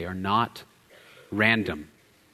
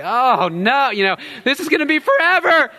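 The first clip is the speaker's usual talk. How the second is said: put-on high, loud child wail; wailing little boy; 10 dB louder than usual. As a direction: son wailing to his father, high and loud. Four-year-old son voiced.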